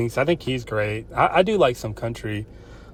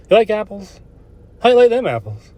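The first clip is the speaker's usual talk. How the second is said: taunt; 9 semitones higher, 7 dB louder than usual. Pretend. Taunting movie line quote.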